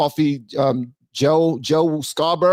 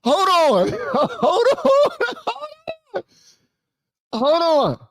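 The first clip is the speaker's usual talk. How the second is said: laughing disbelief; slower than usual. Real, not acted. Reacting in disbelief to clip.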